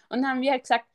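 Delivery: ordinary delivery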